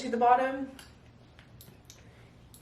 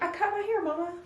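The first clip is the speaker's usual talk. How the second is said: high-pitched, casual imitation of a daughter; nonchalant little child; 10 semitones higher, faster than usual. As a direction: mimic a kid casually confessing to Mama. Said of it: "Just so nonchalant".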